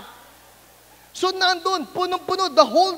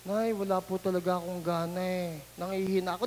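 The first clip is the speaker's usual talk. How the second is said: much lower, softer, listless; weak mopey kid; 8 semitones lower, 8 dB quieter than usual. Retold or imitated. Kid whining weakly to his mother about no appetite. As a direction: sound drained, no appetite.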